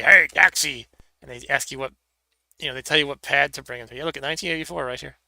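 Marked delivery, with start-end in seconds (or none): 0.0-0.9 loud caller hailing a cab, louder; retro digitized stadium announcer. Retold or imitated. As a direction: imitate the game's digitized voice shouting. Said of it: "they say".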